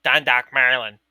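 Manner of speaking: loud mock Dundalk accent. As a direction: imitate the coworker's Dundalk vowels, loudly. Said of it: "that accent checked out".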